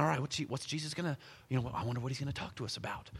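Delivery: quick, soft murmur among friends; hushed, fast aside while settling down to listen; murmured wondering. Acted. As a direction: wonder aloud under your breath, hurriedly. Disciples wondering among themselves, acted.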